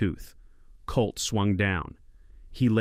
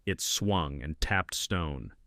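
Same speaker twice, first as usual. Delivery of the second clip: usual speaking voice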